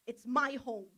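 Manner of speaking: ordinary talk, nothing marked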